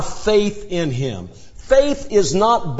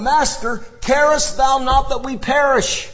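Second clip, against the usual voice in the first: higher, pleading cry over the storm; crying out in fear; 8 semitones higher than usual. Retold or imitated. Disciples crying out to the sleeping Christ over the storm, higher and desperate. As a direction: call out like frightened sailors about to drown. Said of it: "they cry out above the storm".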